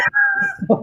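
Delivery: sudden high-pitched laugh; real high laugh; burst of laughter. Real, not acted. Host laughs after joking about time.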